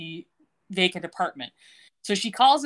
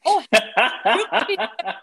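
high, loud, sarcastic with a laugh; villainous sarcastic taunt breaking into laughter; mocking menace; 5 semitones higher, 7 dB louder than usual. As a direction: toss it back at him in mock surprise. [name] mocking Ted back.

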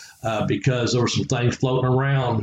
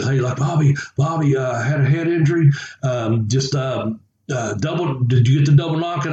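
usual speaking voice

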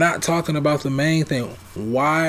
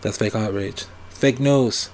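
Donald Trump impression; 3 semitones lower, 4 dB quieter than usual. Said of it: "my best Donald Trump impression".

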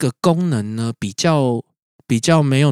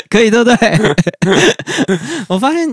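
laughing excited; 9 semitones higher, 5 dB louder than usual. Real, not acted. Delighted the reversal worked.

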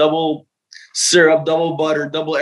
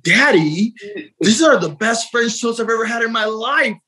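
put-on higher, excited child's voice; excited little daughter; 7 semitones higher than usual. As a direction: gush like a little girl over breakfast. Daughter quoted praising French toast.

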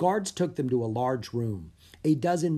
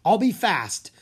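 eager promise; 7 semitones higher, 7 dB louder than usual. Acted. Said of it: "Drake promised".

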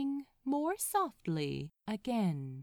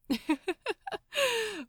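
high, bright happy laugh; delighted laugh; 12 semitones higher than usual. A narrator's reading. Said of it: "The masseur's laugh was a wonderful sound".

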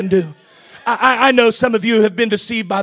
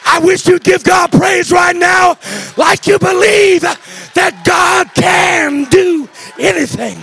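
high, loud, slow climactic shouting; preaching at full force; 6 semitones higher, 5 dB louder than usual. Acted. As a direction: roar it out to the congregation. Preacher at peak intensity.

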